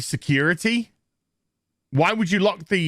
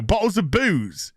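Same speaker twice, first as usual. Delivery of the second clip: normal talking voice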